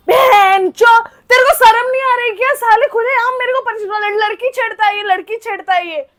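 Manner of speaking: loud, high furious scolding; witch-like rage. Retold or imitated. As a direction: tear into him like a furious witch. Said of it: "चुड़ैल बन गई वहां पे". (चुड़ैल की तरह चीखते हुए गुस्से में डाँटना)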